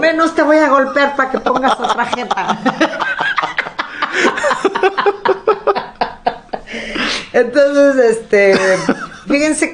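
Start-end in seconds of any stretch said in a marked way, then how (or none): 0.0-2.6 high, loud playful threat to a sad friend; mock threat. Jokes she'll hit a sad friend, loud and mock-tough. 2.6-7.3 long high burst of laughter; real laugh. Real, not acted. Laughing at the joke about hitting friends, high real laughter.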